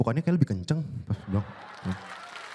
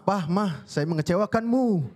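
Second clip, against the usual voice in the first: ordinary talk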